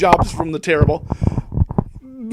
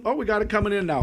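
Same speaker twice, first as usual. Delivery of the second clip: usual speaking voice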